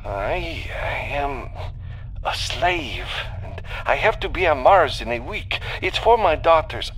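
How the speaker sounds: high, halting voice building to an urgent plea; pleading slave. Acted. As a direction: start haltingly, then rush into pleading.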